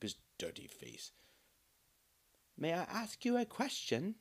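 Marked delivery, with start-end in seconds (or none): 2.6-4.1 put-on much higher, soft child's voice; timid small boy asking; softly voiced little boy; shy boy. Boy asks shyly.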